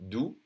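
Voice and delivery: "Doux" ending on the vowel, no X sound; French "Doux", no final consonant at all; silent X. Shown as a demonstration. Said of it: "We don't say the X".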